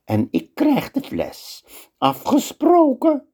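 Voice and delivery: high slow wizard bargaining. Acted. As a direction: play the scheming wizard, slow and sly. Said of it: "zegt de tovenaar".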